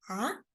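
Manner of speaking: "ah" rising steeply; short questioning "ah" that climbs; second tone. Shown as a demonstration. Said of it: "So it's rising tone".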